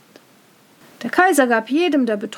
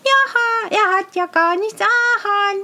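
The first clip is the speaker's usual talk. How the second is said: put-on high child's voice, stretching the last word an; child insisting on the truth, high; little child; 8 semitones higher, 4 dB louder, slower than usual. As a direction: sing out the truth like a small child. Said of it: "sagt ein kleines Kind".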